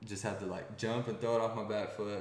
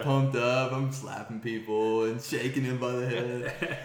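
higher, louder thrilled telling; excited; 4 semitones higher, 6 dB louder than usual. Real, not acted. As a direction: relive the winning throw. Reliving winning touchdown excitedly.

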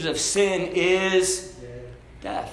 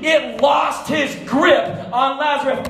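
high, loud pulpit proclamation, stretching key words; preaching at full force; 7 semitones higher, 3 dB louder than usual. Acted.

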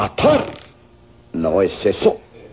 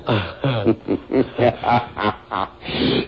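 long sly chuckle; 2 semitones lower, 4 dB quieter than usual. Acted. Villain luring the men with harem pleasures, long chuckle. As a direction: keep chuckling as they take the bait.

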